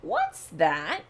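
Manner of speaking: a lightly growled, gruff bear's challenge. A narrator's reading. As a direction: growl it like a big gruff bear. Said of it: "growled a voice that was terribly gruff".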